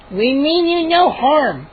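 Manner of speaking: slow, high gnome voice; calm the children slowly. Acted. Gnome reassuring the children, slow and high. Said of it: "Chip said".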